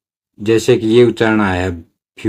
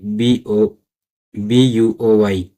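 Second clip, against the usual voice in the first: slow spelling; much slower than usual. Shown as a demonstration. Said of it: "teacher spells buoy".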